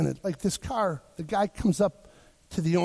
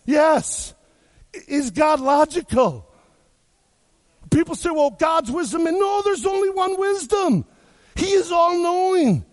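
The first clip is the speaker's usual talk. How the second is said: high, loud preacher's call to the crowd; full-force preaching; 7 semitones higher, 4 dB louder, slower than usual. Acted. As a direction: preach it out big and rising.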